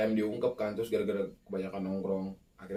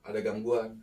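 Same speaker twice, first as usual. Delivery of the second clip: ordinary talk, nothing marked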